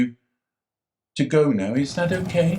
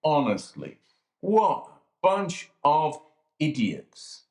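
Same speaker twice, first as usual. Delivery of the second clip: usual speaking voice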